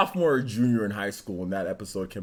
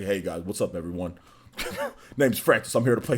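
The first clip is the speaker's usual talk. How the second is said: deep voice; 5 semitones lower, faster than usual. Retold or imitated. Streamer imitating his voice after it broke, deep and low. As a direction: drop the voice low like it just broke. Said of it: "Next minute, I was like".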